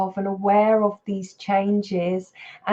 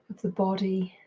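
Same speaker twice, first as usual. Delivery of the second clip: unhurried meditation guidance with long pauses; guided meditation. Real, not acted. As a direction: guide them into body and breath, leaving space between phrases. Leads a mindfulness practice.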